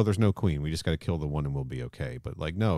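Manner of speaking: normal talking voice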